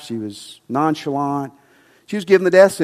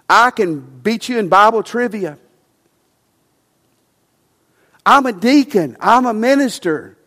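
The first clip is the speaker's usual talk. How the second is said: mock proud voice, higher and louder; boastful churchgoer; 5 semitones higher, 4 dB louder than usual. Pastor mimicking proud members listing credentials, mock boastful. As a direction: puff up like a smug deacon listing credits.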